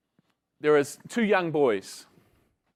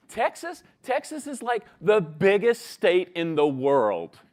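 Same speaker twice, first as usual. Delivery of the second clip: put-on incredulous Texan boy; 3 semitones higher, 5 dB louder than usual. Texan boy amazed at the question.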